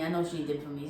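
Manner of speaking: soft, low muttering to himself; quietly talking himself down; quiet self-talk. Retold or imitated. Nervous self-talk at party.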